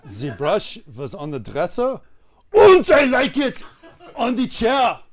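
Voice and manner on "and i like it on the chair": put-on German accent bursting into high, loud insistence. Warm-up leader fussing over a brush, exploding German accent. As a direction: erupt halfway through in comic German. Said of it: "I'm gonna do German".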